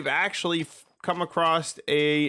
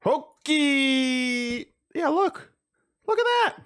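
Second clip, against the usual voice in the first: excited; 12 semitones higher, slower than usual. Real, not acted. Excited discovery, voice jumps high.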